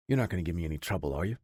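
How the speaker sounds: low, fast and gruff; a hard man who won't be argued with; gruff, no-nonsense. A narrator's reading. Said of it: "in a gruff, no-nonsense voice".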